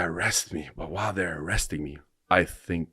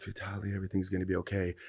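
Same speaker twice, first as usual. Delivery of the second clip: much softer, fast, soothing; a lightly voiced mother reassuring him; mom's comforting voice; 2 semitones higher, 9 dB quieter, faster than usual. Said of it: "I hear my mom".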